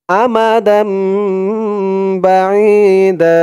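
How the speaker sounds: slow, drawn-out Quranic chant; tajweed recitation. Shown as a demonstration. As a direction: recite it slowly with long held vowels. Recites verse again. (ধীরে টেনে কুরআন তিলাওয়াত)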